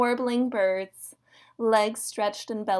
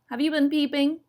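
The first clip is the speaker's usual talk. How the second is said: higher, raised-voice question; shouted; 6 semitones higher, 4 dB louder, faster than usual. A narrator's reading. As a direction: call the question out over noise. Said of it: "shouted Possum".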